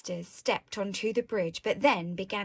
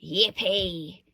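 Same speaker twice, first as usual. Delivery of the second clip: loud but understated cheer; a touch of low-pitched glee; lightly voiced villains' yippee; crying out; 4 semitones lower, 5 dB louder than usual. A narrator's reading. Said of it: "the T's cried out".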